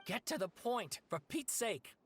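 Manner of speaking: high-pitched impatient outburst; exasperated interruption. Acted. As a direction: cut in, fed up with the rambling. Cutting off a rambling storyteller.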